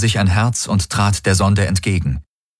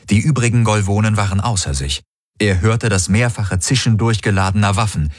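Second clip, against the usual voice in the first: ordinary delivery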